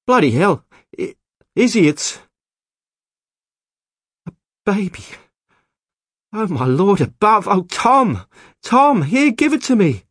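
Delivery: high, loud frantic exclaiming; astonished. A narrator's reading. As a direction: cry out in urgent amazement. Couple astonished at finding a baby.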